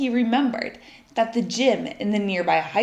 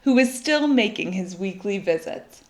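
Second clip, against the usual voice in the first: ordinary delivery, nothing marked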